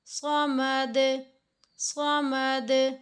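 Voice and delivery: समद with its final द bounced back, slow; slow qalqala on samad. Shown as a demonstration. Teacher modelling the verse ending, slow rebounding d. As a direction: echo the द in समद. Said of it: "कहकर चुप नहीं कर जाना".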